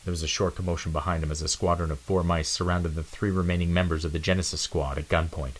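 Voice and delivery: plain reading voice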